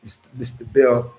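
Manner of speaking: ordinary talk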